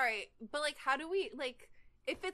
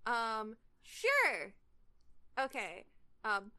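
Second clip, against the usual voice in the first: ordinary delivery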